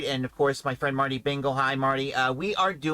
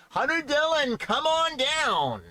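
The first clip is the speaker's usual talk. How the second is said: much higher, drawn-out game show call; George Gray announcer; 13 semitones higher, slower than usual. Retold or imitated. Fan dreaming of hearing his name called at The Price is Right, big announcer call. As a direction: boom it like a game show host calling a contestant. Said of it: "to hear George Gray say".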